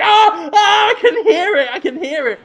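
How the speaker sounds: high frantic cries of panic; panicked screaming. Acted. Brendan Fraser hearing Willem Dafoe inside, panicking. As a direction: scream as the voice invades him. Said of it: "He's like".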